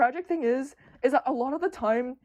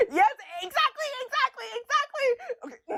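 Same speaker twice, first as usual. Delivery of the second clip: high, squealing, laughing agreement; excited; 12 semitones higher than usual. Real, not acted. Streamer agreeing with chat, squealing excitedly. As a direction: burst out laughing in delight.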